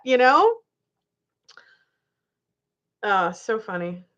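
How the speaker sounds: normal talking voice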